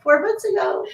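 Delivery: high, mock-sweet four months ago; deadpan retort. Mother snapping back at a nosy stranger, sarcastic.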